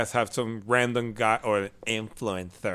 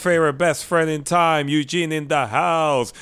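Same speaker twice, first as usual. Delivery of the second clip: grand entrance announcement; 6 semitones higher, 6 dB louder than usual. Acted. Introduces himself as announcer.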